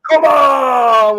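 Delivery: loud, delighted yell; excited shout. Real, not acted. Host shouts happily when a viewer he knows joins the chat. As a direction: shout it out in happy disbelief.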